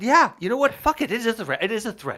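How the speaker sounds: yelled high and loud; shouting threat. Pretend. Escalating mock threat.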